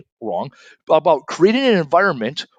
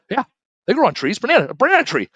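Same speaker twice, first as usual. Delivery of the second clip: mock insistent dad; 10 semitones higher, faster than usual. Dad at game night doubling down, mock insistent. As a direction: push the banana tree claim hard.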